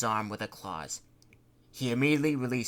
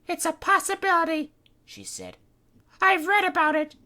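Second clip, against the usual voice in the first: put-on much higher, grave warning girl; Kitty's voice; 8 dB louder than usual. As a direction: warn them darkly. Kitty voice held.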